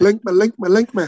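Trinidadian lilt on link me; Trinidadian accent. Retold or imitated. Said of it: "I gotta say it in the Trinidadian accent".

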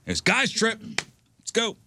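put-on rally the boys for the slopes; guys' trip rallying cry; pumped up. Pumped for a guys' ski day.

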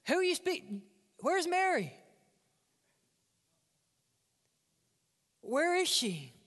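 high confused questioning; mock confusion. Mary at the tomb asking for Jesus, mock bewildered high voice.

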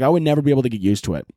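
normal talking voice

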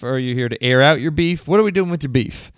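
ordinary talk, nothing marked

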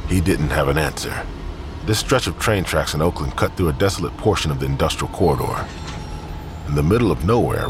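ordinary talk, nothing marked

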